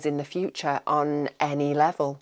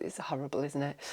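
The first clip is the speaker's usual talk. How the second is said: very quiet; 4 semitones lower, 14 dB quieter, faster than usual. Real, not acted. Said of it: "I've got the hiccups now".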